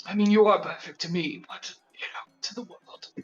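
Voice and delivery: hushed, breathy confidence; whispered aside. Acted. Wizard whispering to the automaton that it's perfect to him, hushed whisper. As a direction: drop to a whisper as if sharing a secret.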